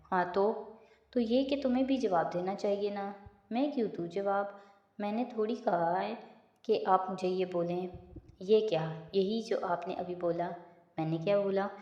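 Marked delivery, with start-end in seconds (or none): none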